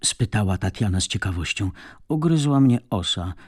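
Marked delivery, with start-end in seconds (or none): 2.1-3.3 high, curt firm answer; tough, defensive reply; hard, firm. A narrator's reading. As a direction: shut the question down, pitched up. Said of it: "odpowiedziała Neda twardo".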